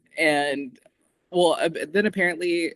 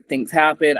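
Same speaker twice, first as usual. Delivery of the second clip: ordinary talk, nothing marked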